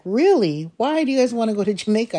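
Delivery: quick startled retelling; surprised reaction. Retold or imitated. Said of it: "I'm surprised my reaction was like".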